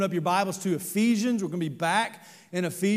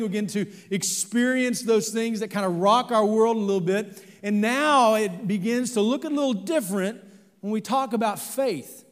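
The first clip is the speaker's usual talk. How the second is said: higher, louder fervent preaching; 4 semitones higher, 5 dB louder than usual. Real, not acted. Pastor preaching about storms of life, fervent. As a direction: drive it home to the congregation.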